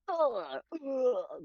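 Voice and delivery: high, strained pain grunts; effort grunts. Shown as a demonstration. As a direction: grunt like taking a hit in a fight. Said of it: "you know".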